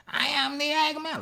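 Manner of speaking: high, wailing singer impression; siren-like vocal imitation. Fan trying to copy the vocal on the track, siren-like. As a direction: wail it like a siren. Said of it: "it kinda sounded like a siren".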